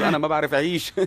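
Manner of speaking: mocking repeat. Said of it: "تخيل إنه أنا ما بعرف أعيش".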